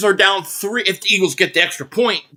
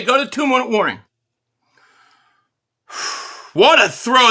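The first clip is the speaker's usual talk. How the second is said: usual speaking voice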